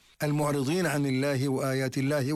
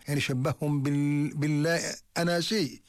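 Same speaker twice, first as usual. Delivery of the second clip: normal talking voice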